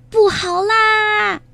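very high, long drawn-out wail; shrieking hen aunt raising the alarm; panicked cry. A narrator's reading. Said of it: "卡里姨妈惊慌地大喊".

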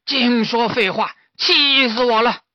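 loud, slow, high exasperated outburst; fed-up old master snapping back; slow loud grumble of annoyance. A narrator's reading.

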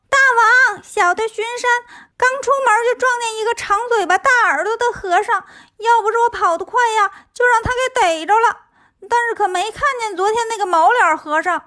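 high, hurried minion voice; excitable high-pitched underling; little demon, reporting. Acted. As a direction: report to the boss breathlessly fast in a high put-on voice.

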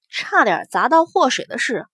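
ordinary delivery, nothing marked

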